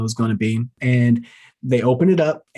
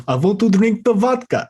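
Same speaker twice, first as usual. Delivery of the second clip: put-on higher-pitched, botched Russian accent; attempted Russian accent; 8 semitones higher than usual. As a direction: have a bad go at Russian. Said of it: "Russian accents are not one of them".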